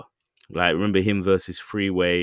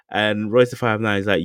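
normal talking voice

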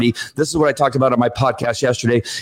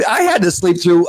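normal talking voice

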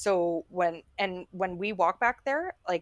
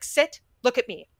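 higher, louder scolding mom voice; lecturing; 8 semitones higher, 13 dB louder than usual. Retold or imitated. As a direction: lecture the dogs like naughty kids. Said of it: "lecturing her dogs".

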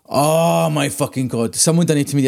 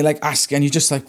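ordinary delivery

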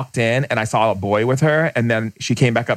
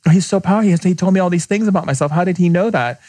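amazed woman; 6 semitones higher, faster than usual. Retold or imitated.

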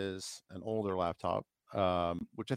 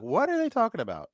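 mock puzzled listener, pitched up high; confused audience voice; 12 semitones higher, 5 dB louder than usual. Co-host voicing puzzled viewers, mock bewildered and high. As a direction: play the baffled viewer wondering aloud. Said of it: "Everyone's like".

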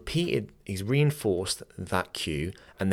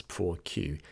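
ordinary talk, nothing marked

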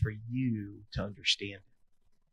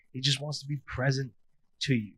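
normal talking voice

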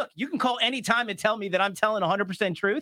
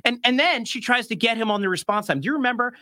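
normal talking voice

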